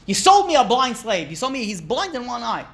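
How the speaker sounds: mock outrage, high and loud at first; indignant complaint. Cheated buyer confronts the slave merchant, indignant.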